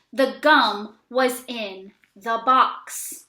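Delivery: slow, word-by-word sentence; teacher reads the changed sentence slowly; slow reading. Shown as a demonstration. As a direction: read it slowly and clearly. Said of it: "change the sentence to say".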